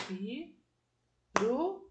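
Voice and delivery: pi-ru-li-to spoken very slowly, one syllable per mouth opening; split into syllables. Shown as a demonstration. Teacher shows pupils how to count syllables, slow and syllable by syllable. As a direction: stretch Pirulito out so each syllable stands apart. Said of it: "Cada vez que você abre a boca".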